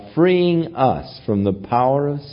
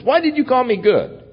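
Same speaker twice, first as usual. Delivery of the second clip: higher, urgent insistence; preacher voices Jesus pressing the young man, higher and fervent; fervent paraphrase; 7 semitones higher than usual. Retold or imitated. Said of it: "Bring it out into the conscious".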